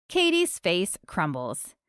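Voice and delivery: plain reading voice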